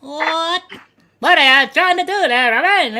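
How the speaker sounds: loud filtered high voice; startled through a voice mod; voice changer. Real, not acted.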